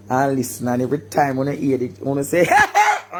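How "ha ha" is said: 1 high mock laugh.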